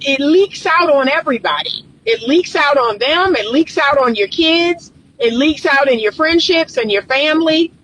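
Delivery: usual speaking voice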